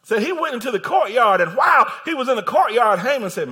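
loud, high-pitched sermon delivery; fired-up pulpit storytelling; full-force preaching. Real, not acted. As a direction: preach it at a shout, driving through the story.

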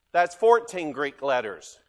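ordinary delivery

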